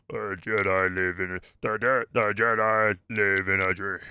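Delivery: low, slow villain voice attempt; struggling to sound deep and menacing; Zipperface impression. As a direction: push the voice down like a masked Sith. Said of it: "I can't get my voice down".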